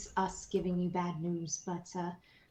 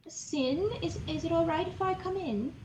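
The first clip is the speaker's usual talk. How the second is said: gentle high voice; 9 semitones higher than usual. Acted. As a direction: ask gently through the door. Gentle voice at a door.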